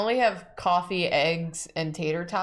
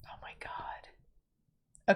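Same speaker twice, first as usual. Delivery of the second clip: whispering; 11 dB quieter, much slower than usual. Real, not acted. Stunned reaction to the tots pun.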